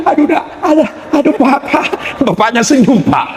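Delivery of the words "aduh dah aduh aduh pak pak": high-pitched mock cries of pain; hammed-up pained mother. Preacher mimics nursing mother calling her husband, hammed-up pained wail. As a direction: wail like a hurting wife, played for laughs.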